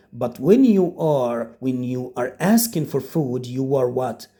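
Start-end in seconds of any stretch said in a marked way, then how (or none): none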